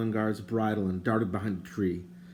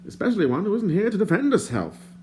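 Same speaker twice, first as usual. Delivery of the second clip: higher, prim and indignant stranger; lightly voiced fussy stranger; 11 semitones higher, 3 dB louder than usual. A narrator's reading. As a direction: play the fussy bard, put out. The bard's voice.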